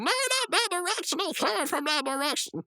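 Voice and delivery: high-pitched cartoon panic; high, alarmed mock cry; panicked protest. Rigby protests in panic while pushing.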